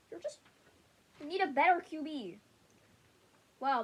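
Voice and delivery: usual speaking voice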